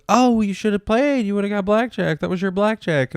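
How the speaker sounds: high, lightly voiced smug lady at the table; gloating lady.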